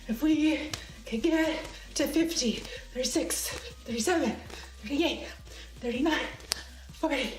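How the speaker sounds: effortful, breathy workout counting; sore from exercise. Real, not acted. Streamer pushing on to fifty, getting sore and puffed. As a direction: puff the count out between jumps. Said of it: "I'm getting sore".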